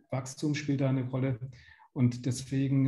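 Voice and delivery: normal talking voice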